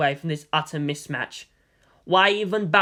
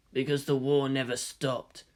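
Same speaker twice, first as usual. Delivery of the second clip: said softly; 3 semitones lower, 6 dB quieter than usual. A narrator's reading. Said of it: "he said softly".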